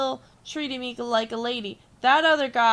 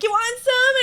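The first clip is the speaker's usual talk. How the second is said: high, loud mocking imitation of a guy; mocking pushy date; 13 semitones higher, 8 dB louder than usual. Date shoving his licked ice cream at her, mocking high shout.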